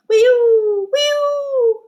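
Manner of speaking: put-on high wailing wee-oo siren for kids; high, loud playful siren imitation; fire truck siren. Shown as a demonstration. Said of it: "Can you make that sound?".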